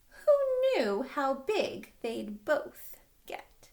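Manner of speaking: slow, wondering storybook close; story ending. Acted. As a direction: draw out the last line. Storybook closing line.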